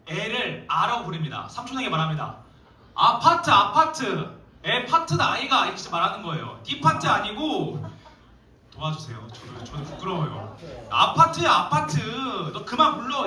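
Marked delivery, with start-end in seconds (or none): 2.9-5.6 loud, high Gyeongsang dialect yell; Gyeongsang scolding. Retold or imitated. Big sister yelling at her brother in Gyeongsang dialect. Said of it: "경상도 사람이었던 것 같아요". 10.9-13.2 loud, high exasperated yell; scolding yell. Retold or imitated. Sister shouting at her brother to stop singing. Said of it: "자기 동생에게 이야기한 겁니다".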